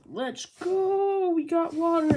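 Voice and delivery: mock excited cheer. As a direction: cheer it like thirsty people getting water. Crowd cheering over water.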